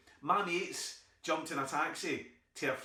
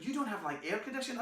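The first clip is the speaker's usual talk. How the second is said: American auntie; 7 semitones higher, faster than usual. Retold or imitated. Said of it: "She went".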